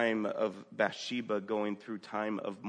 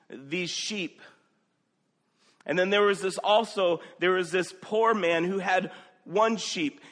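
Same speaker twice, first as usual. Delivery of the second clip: higher, louder storyteller voice, a little quick at first; Nathan telling a parable; 8 semitones higher, 8 dB louder than usual. Retold or imitated. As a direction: tell the prophet's story with urgency. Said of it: "Nathan came to David and he said".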